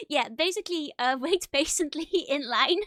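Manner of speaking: giggling through tongue pain; laughing. Real, not acted. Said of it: "my tongue still hurts".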